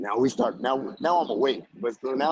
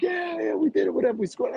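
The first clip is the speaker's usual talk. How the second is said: high-pitched mock cheering; gleeful mock whoop at normal volume; retold celebration. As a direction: imitate yourself celebrating a score, pitched way up. Retold celebrating after a score.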